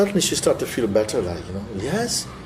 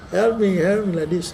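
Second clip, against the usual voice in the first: put-on begging child; 8 semitones higher than usual. Son begging beside his mother.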